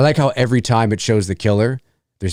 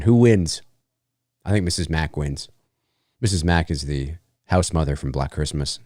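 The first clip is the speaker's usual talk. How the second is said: ordinary talk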